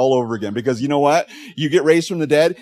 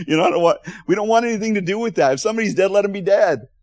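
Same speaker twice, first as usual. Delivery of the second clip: mock panic; 9 semitones higher, 6 dB louder, faster than usual. Voicing the crowd's panicked thoughts.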